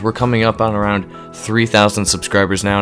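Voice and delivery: ordinary delivery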